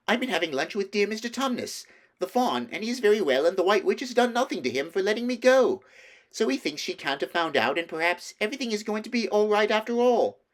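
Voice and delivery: high, loud happy chatter; gushing with news; Lucy excitedly telling her brother. A narrator's reading. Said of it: "too happy and excited".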